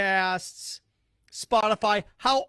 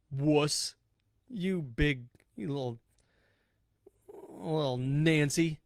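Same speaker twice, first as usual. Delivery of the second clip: mock taunt; 4 semitones lower, 3 dB quieter, slower than usual. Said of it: "That's what you sounded like".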